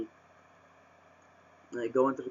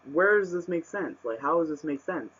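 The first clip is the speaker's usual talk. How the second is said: exasperated questioning; 5 semitones higher than usual. Real, not acted. Baffled rant about the twist.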